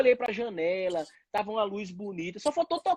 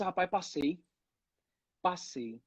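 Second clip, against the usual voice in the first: stunned quiet; 3 semitones lower, 7 dB quieter, slower than usual. Retold or imitated. Realizing he passed.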